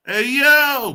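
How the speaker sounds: high, loud chesty "Hey, yo"; chest voice demo. Shown as a demonstration. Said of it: "you was speaking from the thorax".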